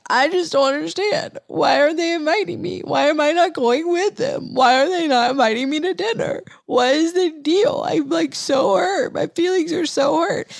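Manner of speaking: put-on high, hurt whimpering like a little kid; childlike crying whine. Calling her husband, hurt and left out, whimpering high like a child. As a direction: whine it out like an eight-year-old about to cry. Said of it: "I started crying like an eight-year-old child".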